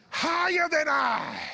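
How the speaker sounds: high, drawn-out shout; preaching shout. Acted. Preacher finishing David's verse for the congregation, high preaching shout. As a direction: shout the verse's climax slowly.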